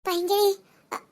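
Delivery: ordinary talk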